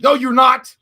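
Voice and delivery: loud, high contradiction; sudden shouted rebuttal; retold outburst. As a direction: blurt it out in mock protest. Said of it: "And I'm like".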